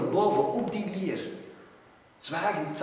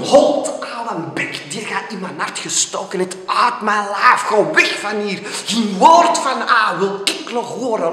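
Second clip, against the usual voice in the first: loud, higher, raging tirade; angry shouting; 6 semitones higher, 13 dB louder than usual. Acted. The poet chasing the raven away, loud angry rant. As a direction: rant at the bird to get out. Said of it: "riep ik toen".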